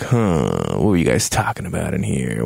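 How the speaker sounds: low-pitched mock voice, probing; David. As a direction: drop low for a suspicious leader. Acts David walking in suspicious.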